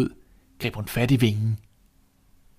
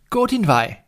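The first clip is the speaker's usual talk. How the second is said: curt dismissal; 10 semitones higher, 4 dB louder than usual. Acted. Rude son dismisses the old man.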